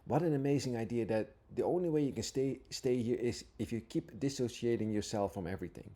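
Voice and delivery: usual speaking voice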